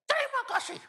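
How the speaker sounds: all-out shout of "Terima kasih"; shouted thanks. Retold or imitated. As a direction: scream "Terima kasih" with everything left. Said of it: "saya teriak".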